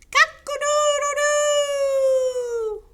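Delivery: very high, long loud crow; crowing horse. Acted. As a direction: crow full out, stretching the last note. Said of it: "and crowed".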